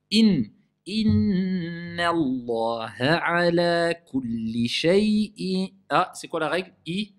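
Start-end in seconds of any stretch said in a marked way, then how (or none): 0.1-5.7 slow chanted recitation of the verse; Quran recitation. Acted. The teacher recites the verse slowly for the class. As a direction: draw the verse out slowly in tajwid style.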